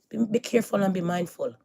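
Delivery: ordinary talk, nothing marked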